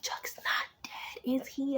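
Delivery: breathless hushed whisper, slowly; a scared whisper. Retold or imitated. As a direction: whisper it in suspense. Whispering retold suspense.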